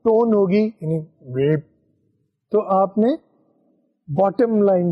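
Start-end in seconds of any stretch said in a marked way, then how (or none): 1.3-1.6 a low hum of a beep. Shown as a demonstration. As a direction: voice the low cue tone. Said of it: "اگر وہ لو tone ہوگی".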